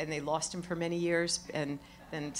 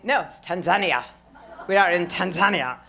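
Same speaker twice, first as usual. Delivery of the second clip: emphatic correction, louder on the name; correcting; 10 dB louder than usual. Retold or imitated. Local correcting a visitor's pronunciation, emphatic correction. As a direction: firmly put the visitor right, punching Tanzania. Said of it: "And they correct you".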